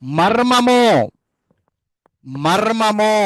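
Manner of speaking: మర్మము called out high and loud; loud model word. Shown as a demonstration. Teacher drills class on మర్మము, calling it out loud. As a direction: call out మర్మము loudly for the class to repeat. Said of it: "loud drill word".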